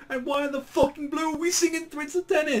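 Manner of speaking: very high whiny complaint; exasperated Gintoki. Retold or imitated. Gintoki complaining about the song, high exasperated whine. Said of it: "It's like".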